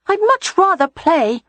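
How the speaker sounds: put-on high child voice, pleading; small boy resisting lessons; reluctant little boy. A narrator's reading.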